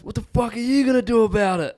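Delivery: high, loud, menacing taunt; squaring up for a fight; aggressive challenge. Retold or imitated. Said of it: "this guy just goes".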